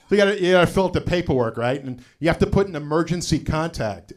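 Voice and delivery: ordinary delivery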